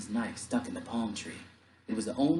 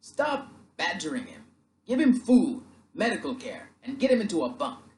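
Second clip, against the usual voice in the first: loud, raised commanding bark; officer barking, pitch raised; barked orders; 9 semitones higher, 5 dB louder than usual. Acted. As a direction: snap out orders to the crew. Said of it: "one man barked".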